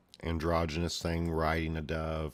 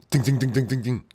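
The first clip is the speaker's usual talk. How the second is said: ding effects; 7 semitones higher, 9 dB louder, faster than usual. Acted. Voiced bolt impact sound effect.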